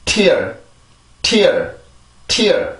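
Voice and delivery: "tear" with crisp aspirated T, falling; English pronunciation drill. Shown as a demonstration. Teacher models the word for learners, drilled English "tear". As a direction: release the T, then glide into r on "tear". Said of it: "다시 한번".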